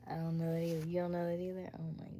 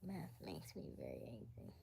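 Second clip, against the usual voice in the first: usual speaking voice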